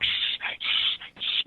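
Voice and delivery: shushing whisper, slow; library whisper. Retold or imitated. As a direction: hush them like a librarian. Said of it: "being like".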